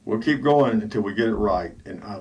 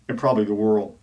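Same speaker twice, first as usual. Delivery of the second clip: ordinary delivery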